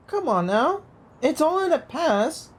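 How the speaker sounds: normal talking voice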